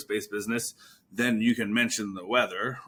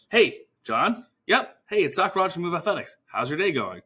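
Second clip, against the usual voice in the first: higher, upbeat greeting; friendly phone opener; 6 semitones higher than usual. Shown as a demonstration. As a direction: greet him like a real person. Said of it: "That's the rapport".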